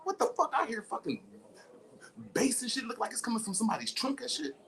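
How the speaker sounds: high shouted mock confusion; startled elephant. Elephant in the hole hearing bass, high startled shouts. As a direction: shout up, baffled by the noise. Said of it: "He like".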